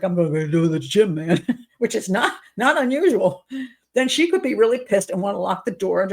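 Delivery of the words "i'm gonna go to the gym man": dismissive guy. Retold or imitated. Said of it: "the guy's like".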